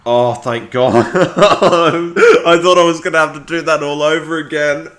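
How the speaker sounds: loud, high laughing relief; relieved laughter. Real, not acted. Relief after the scare.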